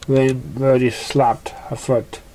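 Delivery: ordinary delivery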